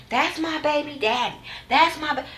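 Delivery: soft, high mocking whine; mocking Ariane. Mocking Ariane's whiny claim.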